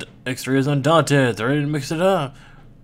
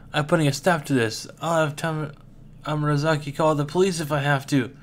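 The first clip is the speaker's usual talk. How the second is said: ordinary talk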